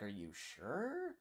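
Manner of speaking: mock-doubtful, drawn-out rising query; skeptical questioning. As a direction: draw it out as you question their certainty. Skeptical reply to physicists, retold.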